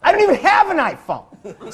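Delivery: high, loud laughing through words; loud laughing rant; laughing loudly. Real, not acted. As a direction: crack up while talking.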